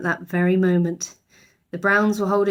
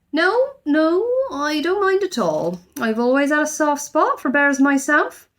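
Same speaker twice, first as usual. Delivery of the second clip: higher, slower voice warming to the idea; kindly housekeeper; 8 semitones higher than usual. A narrator's reading. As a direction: give in with a fond note. Voices Mrs Bird.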